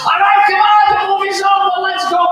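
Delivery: high, loud, hurry-up mom voice; impatient mother. Retold or imitated. Mother rushing the family home after the movie, impatient. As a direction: shoo everyone out of the theater.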